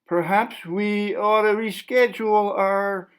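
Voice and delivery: a cautious reporter edging around him; nervous interviewer. Acted. Interviewer hesitantly asking the artist to stop, lightly voiced.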